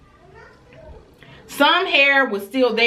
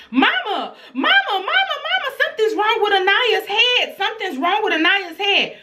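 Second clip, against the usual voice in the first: put-on high, frantic little girl calling mama; high-pitched child's alarm; panicked child voice; 11 semitones higher than usual. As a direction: imitate a scared kid waking her mom. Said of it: "she's like".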